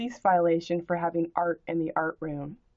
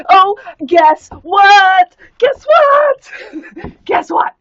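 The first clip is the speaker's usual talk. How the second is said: much higher, louder shrieking glee with laughter; mock screaming excitement; 11 semitones higher, 14 dB louder, slower than usual. Taunting the commenter, shrieked mock delight. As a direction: scream it like overjoyed. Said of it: "Is this enough excitement for you?".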